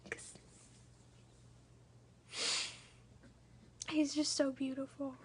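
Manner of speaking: holding back tears, voice full of emotion; teary-eyed. Real, not acted. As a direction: near tears over her newborn son. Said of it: "Sorry I got all teary-eyed".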